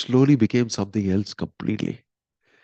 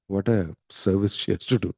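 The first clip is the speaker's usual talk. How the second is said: ordinary talk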